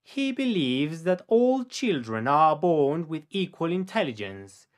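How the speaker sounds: slow, careful read for repeating; each word laid out slowly for the learner; slow model sentence. Shown as a demonstration. Teacher to student, slow model sentence for repetition.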